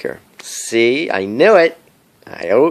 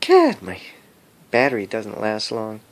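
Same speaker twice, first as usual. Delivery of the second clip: normal talking voice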